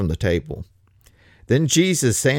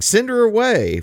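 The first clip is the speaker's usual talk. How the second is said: urgent demand; 10 semitones higher, 4 dB louder than usual. Retold or imitated. Disciples urging Jesus to dismiss her.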